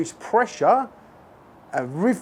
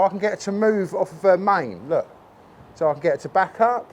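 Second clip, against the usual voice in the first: ordinary delivery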